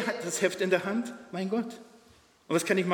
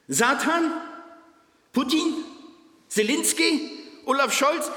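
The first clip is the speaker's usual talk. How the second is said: steigende Aufregung; 9 semitones higher, slower than usual. Acted. Rhetorische Aufzählung steigend.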